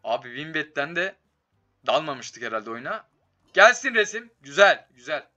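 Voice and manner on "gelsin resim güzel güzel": excited cheer. Real, not acted. Cheering a bonus hit.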